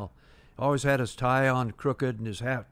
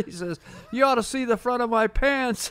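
laughing; 9 semitones higher than usual. Retold or imitated. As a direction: still chuckling, much higher pitched. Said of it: "he's sort of laughing".

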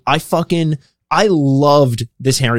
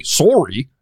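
imitated Canadian accent. Canadian mocking imitators of his accent, mock Canadian. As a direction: say "Sorry" as someone faking a Canadian accent. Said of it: "if someone's doing a Canadian accent".

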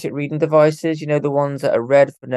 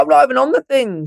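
much higher, louder incredulous protest; indignant protest; 11 semitones higher, 4 dB louder than usual. Retold or imitated. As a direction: object in disbelief, half laughing. Protesting his name on Ouija board.

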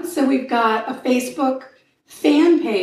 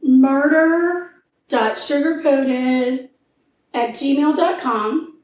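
slow and clear; slower than usual. Real, not acted. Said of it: "spelling out address slowly".

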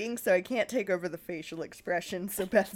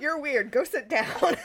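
dismissive teacher; 7 semitones higher, 5 dB louder than usual. Retold or imitated. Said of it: "I just like that "you're weird".

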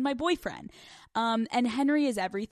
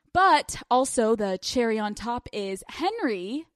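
ordinary talk, nothing marked

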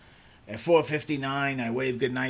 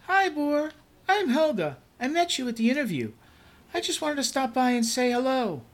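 much higher, bright cheerful coworker voice; bubbly coworker; 14 semitones higher, 4 dB louder than usual. Acted. Helga introducing herself to the new boss, much higher cheerful voice. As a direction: greet the new boss sweetly and eagerly.

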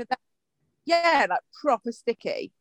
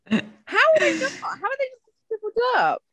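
incredulous; 13 semitones higher than usual. Real, not acted. Amazed at riders in heat.

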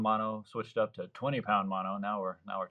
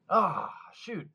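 determined excitement; 8 semitones higher than usual. Retold or imitated. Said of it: "And I was like".

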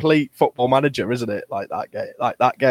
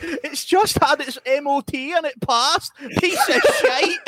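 much higher, slow mock Scottish woman; 14 semitones higher, slower than usual. Contestant doing [name] stuck with a dead Vespa, high Scottish. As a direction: play a frustrated Scottish singer whose scooter won't start. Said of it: "I'm gonna do [name]".

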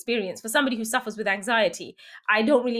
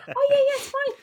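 breezy husband; 4 dB louder than usual. Retold or imitated. Husband voiced, breezy dismissal.

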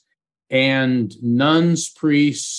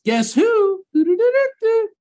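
much higher, chirpy cartoon woodpecker call and laugh; Woody Woodpecker laugh. The skeptic's daddy at her bedroom door, high Woody Woodpecker laugh. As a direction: pop in with a cartoon bird cackle. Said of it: "Like Woody Woodpecker".

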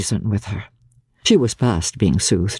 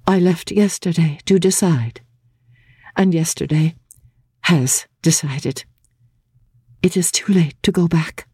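lightly voiced young woman, higher, gentle yet firm; gently but firmly; 5 semitones higher than usual. A narrator's reading. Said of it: "gently but firmly".

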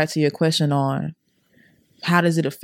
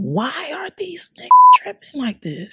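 exasperated outburst; 10 semitones higher, 4 dB louder than usual. Real, not acted. Exasperated complaint about family.

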